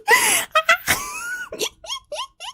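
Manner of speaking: squealing burst of real laughter; high laughing shriek. Real, not acted. Cracking up at the accent.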